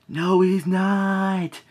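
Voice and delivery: high, long drawn-out "No, he's not"; a kid refusing to believe it; disbelieving protest. Retold or imitated. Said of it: "I'm like".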